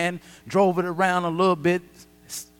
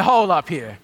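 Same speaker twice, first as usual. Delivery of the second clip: retold exclamation; 7 dB louder than usual. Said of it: "that's when I said".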